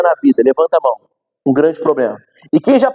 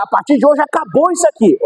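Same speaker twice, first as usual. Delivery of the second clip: higher, decisive proclamation; firm declaration; 8 semitones higher than usual. Shown as a demonstration. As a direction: declare it done, pitched up. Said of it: "Tem gente que chega na hora".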